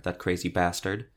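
ordinary delivery

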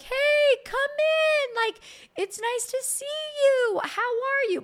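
fake-friendly hello to the ex walking in; squeaky high awkward welcome; awkward cheery hello. Awkward greeting in delivery room.

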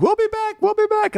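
very high, sing-song mocking voice; mock guest promise. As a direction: squeak it like a fake-cheerful tourist. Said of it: "I was like".

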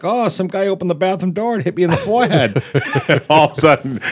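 can't keep a straight face; laughing. Real, not acted.

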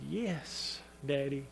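very soft, low retold child's answer; meek, quiet assent. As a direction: answer in a small hushed voice. Small daughter answers, retold softly.

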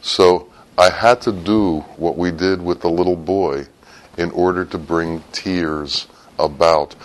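ordinary talk, nothing marked

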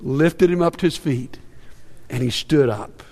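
soft, low, hushed narration; tender storytelling with gentle pauses. A narrator's reading. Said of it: "What a tender moment".

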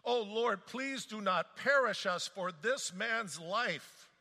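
desperate prayer. Acted. Sailors praying desperately.

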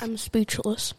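muffled through a mouthful; soft words through food; mouth full. Real, not acted. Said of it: "your mouth is full of sugar?".

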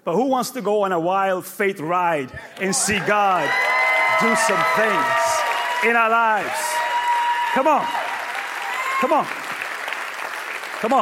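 loud calls from the pulpit; rallying shout. Real, not acted. Pastor rousing his church to faith, shouting. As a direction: whip the crowd up.

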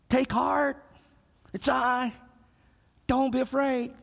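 calm frightened men in the storm; Jesus comforting. Acted. Jesus reassuring the disciples.

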